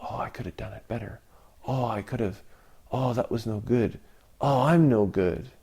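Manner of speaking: quick, hushed self-reproach under the breath; soft anxious grumble of a doubting hermit; self-critical muttering. Retold or imitated. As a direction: mutter it as if berating yourself. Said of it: "Or does he suddenly spiral into this".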